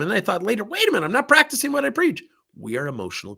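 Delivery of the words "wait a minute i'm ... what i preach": sudden realization. Retold or imitated.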